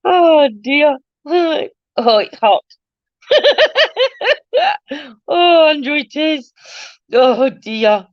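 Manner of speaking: high, giggling "oh dear"; laughing through the words; breathless, tickled giggle. Real, not acted. Laughing after a chat joke.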